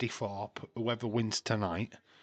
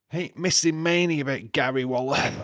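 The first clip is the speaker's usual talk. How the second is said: excited shout; 5 semitones higher, 9 dB louder than usual. Real, not acted. Excited wrestling fan outburst.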